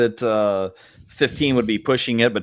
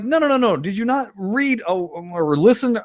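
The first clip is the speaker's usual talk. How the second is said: mock exasperated correction; 7 semitones higher than usual. Answering the imagined panicked commenters.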